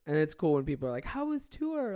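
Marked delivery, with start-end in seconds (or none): 1.0-2.0 high, bright-eyed fan question; mock fan voice. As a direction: play an eager fan asking. Mimicking fans asking about tour.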